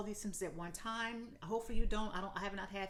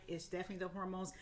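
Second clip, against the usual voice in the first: ordinary delivery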